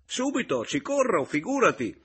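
high, loud, agitated burst; almost angry. A narrator's reading. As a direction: snap at him, exasperated and urgent. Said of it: "scattò Roberto quasi rabbiosamente".

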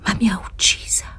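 whispered with immense regret; a hushed, regretful whisper. A narrator's reading. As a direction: let the regret break through a whisper. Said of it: "con un rimpianto immenso".

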